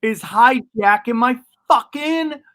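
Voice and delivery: high, drawn-out mock outrage; acted exasperation. Comic reliving seeing his showcase hijacked, mock outraged. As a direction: stretch the curse in rising, hammed-up frustration. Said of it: "And I'm like".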